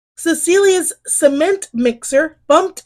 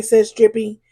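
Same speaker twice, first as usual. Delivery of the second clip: plain reading voice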